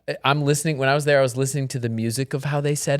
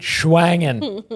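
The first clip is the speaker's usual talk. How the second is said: goofy mock word; 3 semitones higher than usual. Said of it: "How do you like your meat?".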